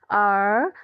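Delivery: আর stretched slowly with the tongue curled back; retroflex er sound. Shown as a demonstration. Teacher correcting the example for a student, আর as a slow retroflex r. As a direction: draw out the r of আর, curled. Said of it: "এই জিনিসটাকে বলা হয় আর".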